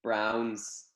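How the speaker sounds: "Browns" in an American accent, open "ow" vowel; Browns, put-on American accent. Shown as a demonstration. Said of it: "I'll say it in an American accent".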